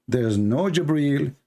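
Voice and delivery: ordinary delivery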